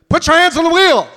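loud, high barked police order; trooper's command. Retold or imitated. State trooper ordering the driver, loud barked command. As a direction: bark it like a cop.

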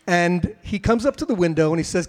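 usual speaking voice

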